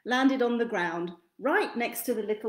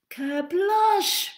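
loud kerblush effect; 9 semitones higher, 5 dB louder than usual. A narrator's reading. Sound effect.

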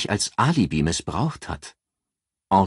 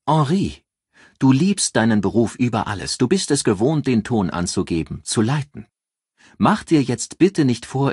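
higher, louder assured female voice; raised voice for a calculating friend giving advice; Ann, cool strategist; 4 semitones higher, 4 dB louder than usual. Acted. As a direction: lay out the plan like a cool strategist. Ann lecturing Henri.

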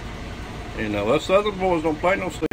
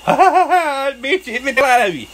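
high, laughing mockery of them; mocking their laughter; 10 semitones higher, 3 dB louder than usual. Imitating the owners laughing at their biting dog, high mocking laugh. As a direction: cackle through it like the dog owners did. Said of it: "they'd laugh".

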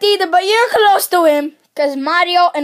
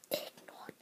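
breathy whispered aside; 26 dB quieter than usual. Acted. Kid whispering to viewers to ignore his brother. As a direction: hiss it to the viewers behind his back.

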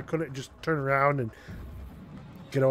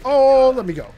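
pleading shout; 7 semitones higher, 8 dB louder than usual. Real, not acted. Caught by enemies.

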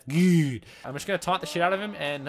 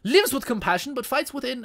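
put-on higher-pitched, not-quite-Indian accent; dubious Indian accent, pitched up; attempted Indian accent; 7 semitones higher than usual. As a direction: try a shaky-footed fake Indian accent. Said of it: "just not do that voice".

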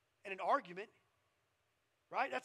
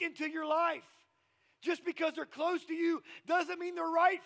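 loud, raised-pitch sermon exhortation; emphatic, forceful pulpit delivery; preaching; 7 semitones higher, 8 dB louder than usual. Real, not acted. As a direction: drive the warning home to the congregation.

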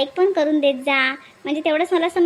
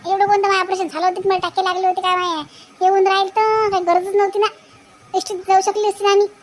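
higher, nagging village woman's complaint; grumbling woman; 4 semitones higher than usual. Acted. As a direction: grumble on and on about the needless car. Nagging woman complains about car. (तक्रार करणारी बाई, उंच आवाजात कटकट)

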